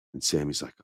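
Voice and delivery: usual speaking voice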